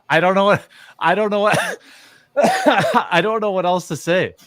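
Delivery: giggling so hard the words stall and restart; laughing at his own joke, unable to finish. Real, not acted.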